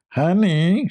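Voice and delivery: lightly voiced high nasal "Honey"; a hint of a nasal drawn-out wife; nasal Kamala impression. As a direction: talk through the nose, mocking. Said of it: "she speaks from her nose".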